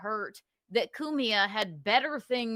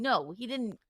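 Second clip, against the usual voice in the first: ordinary talk, nothing marked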